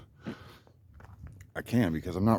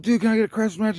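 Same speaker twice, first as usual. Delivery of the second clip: much higher, louder plea; pleading call; 13 semitones higher, 5 dB louder than usual. Retold or imitated. Asking the neighbor again for a wrench, pleading high. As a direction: beg across the gate. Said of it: "I'm like".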